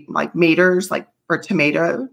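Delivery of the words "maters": "maters" as MAY-terz, first syllable dropped; dad's Southern maters. Retold or imitated. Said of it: "my dad is infamous for saying".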